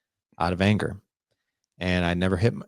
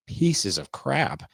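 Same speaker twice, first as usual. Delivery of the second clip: slow, contemptuous, higher pitched; disgusted; 6 semitones higher, much slower than usual. Real, not acted. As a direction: drag it out in disgust. Disgust at mom's abusive boyfriends.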